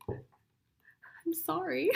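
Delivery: slow, breathy whispered apology; sheepish whispered sorry. Real, not acted. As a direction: whisper it while stifling laughs. Giggling apology.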